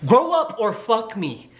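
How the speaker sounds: high, drawn-out mock demand; slow, high-pitched playful dare; joking demand. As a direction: stretch it out as a cheeky punchline. Adds a raunchy joke punchline.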